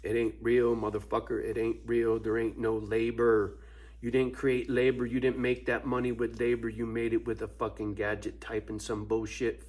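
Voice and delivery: ordinary talk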